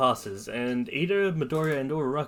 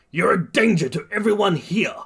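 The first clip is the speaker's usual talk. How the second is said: higher, louder accusing yell; 8 semitones higher, 6 dB louder than usual. A narrator's reading. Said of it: "Bakugo just yells".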